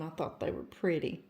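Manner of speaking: normal talking voice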